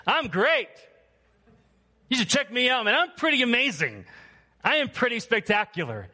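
high, loud, puffed-up bragging; mock boastful Simon. Preacher mocking Simon bragging to the crowd. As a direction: strut like a show-off magician.

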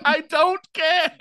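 high, drawn-out mock outburst; retold defiance. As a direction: stretch each word in exaggerated dismissal. Repeats the outburst amid laughter.

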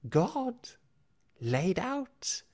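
high, loud rasping creature voice; gloating rasp, unsettling. Acted. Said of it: "a rasping voice".

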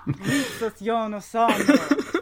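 slow careful "Ritzas io no sonaro", trilled R; rolled r. Shown as a demonstration. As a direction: roll the R in "Ritzas", slowly. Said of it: "a nice rolling R for the first sound".

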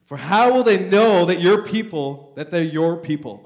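loud, slow, preaching-style reading; scripture reading. Acted. Preacher reading Moses' plea to the church, loud and proclaiming. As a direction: proclaim the verse to the congregation.

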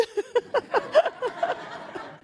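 high unvoiced giggle; high laugh. Real, not acted. Wife laughing at her own joke, high giggle. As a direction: let out a breathy laugh.

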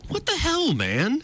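incredulous. Real, not acted. Incredulous outburst.

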